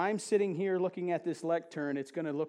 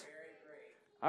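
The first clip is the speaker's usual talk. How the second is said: whisper; 9 dB quieter than usual. Acted. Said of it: "whispered ending of reading".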